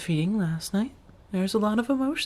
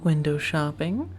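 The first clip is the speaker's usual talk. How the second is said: usual speaking voice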